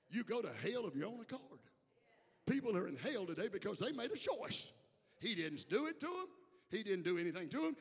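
loud, insistent sermon delivery; forceful preaching. Real, not acted. Preacher telling church that hell is chosen, forceful preaching.

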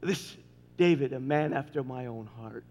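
soft, low tender words of God; hushed quote. Retold or imitated. Pastor quoting God's praise of David, soft and low. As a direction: let it fall soft and low. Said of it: "He said".

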